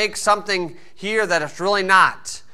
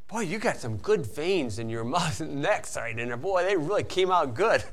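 mock admiring quiet remark; 2 semitones lower, 10 dB quieter than usual. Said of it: "you wanna say".

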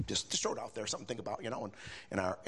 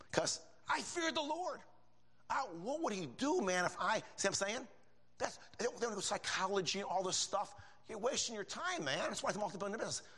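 fervent preaching; 8 semitones higher than usual. Acted. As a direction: preach it to the rafters. Preaching at full force.

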